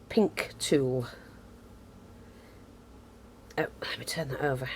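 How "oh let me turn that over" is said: quick whisper to herself; whispered aside. Real, not acted. Crafter muttering to herself while turning the fabric, whispered. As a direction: drop to a hurried whisper.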